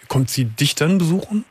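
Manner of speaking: ordinary talk, nothing marked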